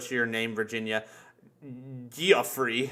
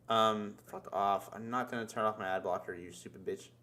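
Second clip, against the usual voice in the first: annoyed muttering; 6 semitones lower, 9 dB quieter than usual. Real, not acted. Muttering at a site's pop-up.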